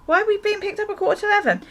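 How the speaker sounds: high, loud incredulous protest; retelling her own outraged what?; shocked disbelief. Retold or imitated. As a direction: squeal your disbelief at the plan. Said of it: "I was like".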